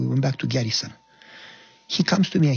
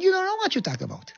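much higher, urgent warning; alarmed lieutenant; 3 dB quieter than usual. Retold or imitated. Lieutenant warning Goya about military prison, alarmed high pitch. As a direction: squeal it out in disbelief.

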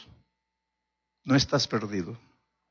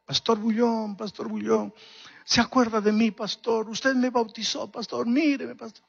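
mild retold call, pitched higher; calling to the pastor across the crowd, higher; 7 semitones higher than usual. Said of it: "me gritaba". (llamada imitada, más aguda)

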